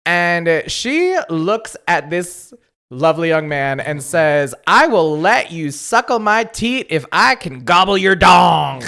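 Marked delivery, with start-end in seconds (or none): 4.7-8.8 put-on loud cackling old crone; creepy old granny voice. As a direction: belt it like a wild old hag. Voicing creepy granny line.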